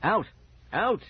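forceful, irritated shout; angry order. A narrator's reading. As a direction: order them away, one word at a time. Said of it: "Dad repeated angrily".

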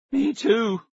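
voice cracking. A narrator's reading. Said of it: "his voice cracking".

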